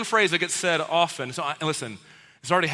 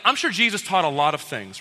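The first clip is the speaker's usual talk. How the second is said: usual speaking voice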